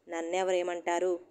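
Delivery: usual speaking voice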